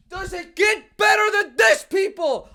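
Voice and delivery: high, loud showman hype; big excited opener, slowing on the last line; hype shout. Real, not acted. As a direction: yell it out to open the show. Hype intro to the show.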